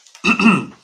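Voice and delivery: loud harsh chest cough; chesty cough. Real, not acted. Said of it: "I've got a wicked chesty thing".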